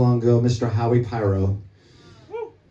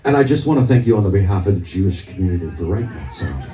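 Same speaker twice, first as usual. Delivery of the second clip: a lower, rumbling hint of the rock star; 5 semitones lower than usual. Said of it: "and he talks like this".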